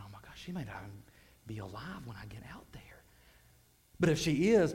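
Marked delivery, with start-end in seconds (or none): 0.0-3.0 soft, unvoiced dread; hushed horrified whisper; whispered fear. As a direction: murmur the worst fear to yourself. Fearful private thought.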